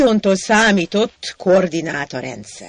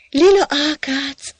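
higher, slow drawn-out call; 8 semitones higher, 3 dB louder than usual. Acted. Hacsek calling Lila ákác from the park bench, higher and slow. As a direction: call her name across the park.

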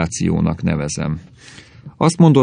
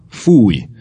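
louder burst of revulsion; sudden loud cry, falling sharply; yelled disgust; 2 semitones higher, 5 dB louder than usual. A narrator's reading. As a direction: shout it out in disgust. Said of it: "ordította".

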